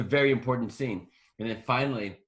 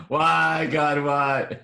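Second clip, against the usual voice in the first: loud cry to heaven, played for laughs; mock anguished plea; 3 semitones higher, 8 dB louder, much slower than usual. Jokingly pleading with God, mock anguish. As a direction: wail it out in mock despair.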